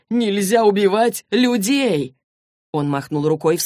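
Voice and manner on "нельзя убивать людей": slow, emphatic echo of a protest; emphatic agreement. Acted. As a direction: repeat it back deliberately, slightly raised. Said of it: "согласился Джейс". (медленно и с нажимом соглашаясь)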